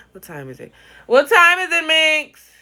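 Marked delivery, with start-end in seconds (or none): 1.0-1.9 loud high call. Real, not acted. Calling out. 1.9-2.5 loud high call across the house. Real, not acted. Calling out to someone.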